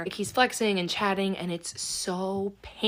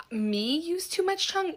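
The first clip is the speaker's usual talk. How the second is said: incredulous retort; 9 semitones higher than usual. Retold or imitated. Retorting to his kissing advice.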